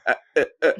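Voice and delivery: high, choppy broken syllables; stuttered bits of a lost connection; call cutting out. Retold or imitated. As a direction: mimic a dropping phone line. Mimics the call cutting out again.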